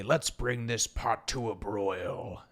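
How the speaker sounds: drawn-out menacing chef one-liner; chef villain. Acted. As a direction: draw it out like a game-villain chef. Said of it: "He would say".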